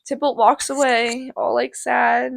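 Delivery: ordinary delivery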